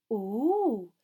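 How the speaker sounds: high, swooping nosy delight; eager, loud curiosity; keenly. A narrator's reading. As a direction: pounce on the gossip. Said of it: "she said keenly".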